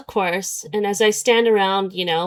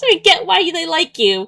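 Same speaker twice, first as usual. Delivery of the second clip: higher, mocking singsong; mocking repeat; 7 semitones higher than usual. Mocking the line heard a million times, higher. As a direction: parrot the line back, fed up.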